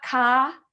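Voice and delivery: "ka" with a full, open "ah"; clear unreduced vowel. Shown as a demonstration. Teacher showing what it's not, "ka" said clear and stressed. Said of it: "if I make that clear".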